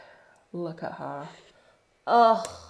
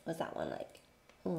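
normal talking voice

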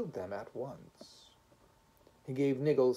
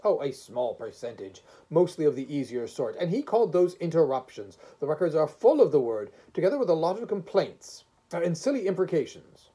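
higher and louder, insistent objection; stern first voice; 5 semitones higher, 9 dB louder than usual. Acted. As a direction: press the case as a critical judge.

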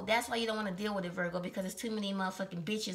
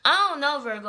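exasperated outburst; 5 semitones higher, 9 dB louder than usual. Real, not acted. Exasperated at slip.

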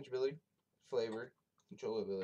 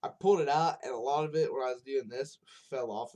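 excited; 4 semitones higher, 9 dB louder than usual. Real, not acted. Defending himself about dropping.

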